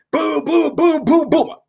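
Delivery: high mimicked boom boom of blows; punch sounds. Teller miming the girls beating her, high booms. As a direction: pop out each boom like a punch landing.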